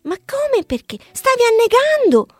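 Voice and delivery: high, loud disbelief; incredulous. A narrator's reading. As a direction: bear amazed at the question.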